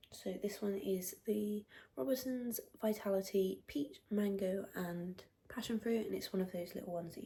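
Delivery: hushed whisper. Real, not acted. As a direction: keep it to a whisper. Whispering product description.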